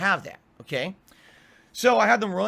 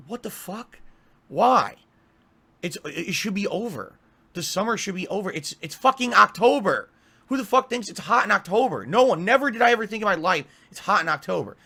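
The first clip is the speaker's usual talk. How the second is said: louder, higher mock outrage at the heat; exasperated rant; 5 semitones higher, 4 dB louder than usual. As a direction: vent like you can't believe it's still hot. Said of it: "I'm like".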